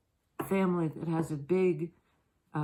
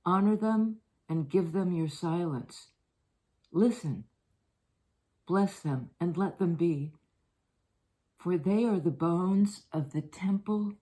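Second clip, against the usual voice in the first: poem reading. Acted. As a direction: read it like a blessing, unhurried. Reads a devotional poem aloud.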